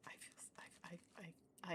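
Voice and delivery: faint hushed whisper; near-silent whisper. Real, not acted. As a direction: keep it under your breath. Whispering beside sleeping cat.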